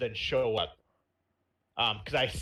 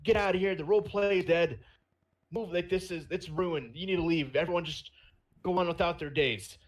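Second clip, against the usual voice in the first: higher-pitched, worked-up mock ranting; mock freaking out; 6 semitones higher than usual. Dude ending the role play, mock freak-out. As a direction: order everyone out, mildly hammed-up. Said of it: "some dude freaking out".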